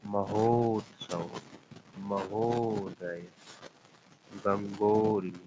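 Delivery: each word drawn out slowly, syllable by syllable; slow teaching demo. Shown as a demonstration. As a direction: spell out महोत्सव, महोदय, गंगोरी slowly so the ओ is heard. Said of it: "ओ वाला उदाहरण देखिए". (ओ की ध्वनि पर ज़ोर देकर धीरे-धीरे बोलिए)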